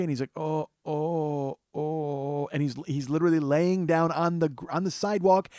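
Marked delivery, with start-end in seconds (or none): 0.4-2.5 drawn-out mock moans of an injured man; imitated moaning. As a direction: moan like someone holding a hurt head. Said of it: "he's laying there moaning".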